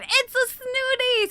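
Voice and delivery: high, slow, showy reveal; excited announcement. Acted. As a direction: present it like a grand reveal. Announcing a snooty.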